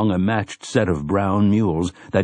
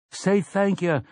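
crowd reply; 11 semitones higher than usual. A narrator's reading. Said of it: "they replied".

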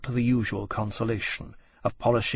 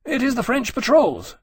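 higher-pitched, brisk reporting officer; lightly voiced earnest officer; 12 semitones higher, 4 dB louder than usual. Officer briefs his friend on the blockade, earnest. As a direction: explain it eagerly, pitched up. Granby voiced, explaining the alarm.

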